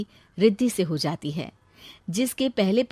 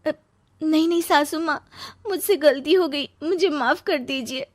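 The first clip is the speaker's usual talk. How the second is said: desperate pleading; 7 semitones higher than usual. Acted. Neeru begs mother-in-law.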